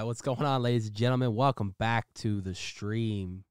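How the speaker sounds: ordinary delivery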